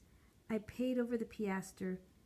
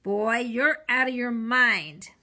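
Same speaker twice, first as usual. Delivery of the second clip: scolding; 2 semitones higher, 13 dB louder, slower than usual. A narrator's reading. Seller scolds the boy.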